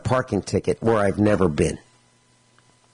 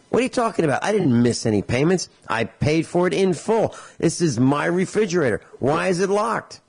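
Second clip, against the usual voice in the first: higher-pitched, indignant protest; acted-out outraged customer disputing a charge; 5 semitones higher than usual. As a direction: argue back in mock indignation, pitch raised. Customer disputes locked refrigerator.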